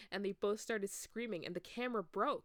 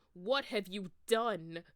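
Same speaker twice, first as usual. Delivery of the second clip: slow, louder stern accusation; angry demand; 8 dB louder, slower than usual. Acted. Dumbledore confronting Ebony, slow and angry. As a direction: lean into each word, furious. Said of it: "walked in angrily".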